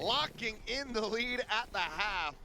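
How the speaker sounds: high, slow closing call; esports caster. Real, not acted. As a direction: land the round like a caster. Live play-by-play call.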